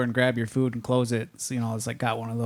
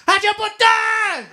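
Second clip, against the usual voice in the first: very high, loud, drawn-out screech; hammed-up yell at the top of the range; mock yelling; 6 dB louder, slower than usual. Counter lady yells his order to the cooks, mock screech. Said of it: "she yells my order as soon as I order".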